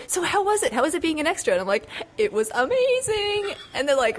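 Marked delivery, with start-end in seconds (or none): 0.1-1.5 high-pitched curious questioning; mock eager friend, pitched up; eager friends imitated. 2.2-3.5 high, slow, stretched gush; imitated giddy delight; acted-out gushing. Acts out gushing answer to friends.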